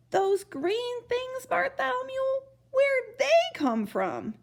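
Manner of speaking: high, slow, scared trumpeter; frightened trumpeter. A narrator's reading. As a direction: stammer it out in dread.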